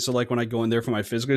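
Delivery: ordinary delivery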